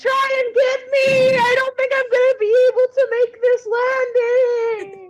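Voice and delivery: hammed-up yell from midair; calling for rescue. Falling character calls to rescuer.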